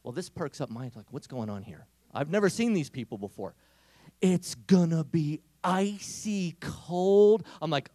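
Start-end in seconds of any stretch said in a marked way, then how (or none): 4.2-7.5 drawn-out, slow, louder gloating promise; slow mock-menacing threat; slow ominous tease. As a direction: stretch every word like a slow taunt. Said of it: "friend's slow drawn-out threat".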